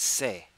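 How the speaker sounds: "c'est" said se, the t silent; French c'est. Shown as a demonstration. Teacher modelling, "c'est" as se. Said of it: "It's pronounced".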